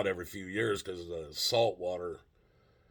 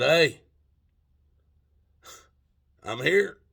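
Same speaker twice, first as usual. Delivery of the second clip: higher, louder bright greeting; loud cheery hello; 8 semitones higher, 8 dB louder, slower than usual. Retold or imitated. Narrator greeting Slick in the bar, loud and cheery. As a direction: announce yourself loudly with mock bravado.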